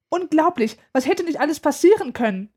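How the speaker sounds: agitated mother. A narrator's reading. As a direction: fret aloud like an upset mother. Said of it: "Aufgebracht läuft meine Mutter".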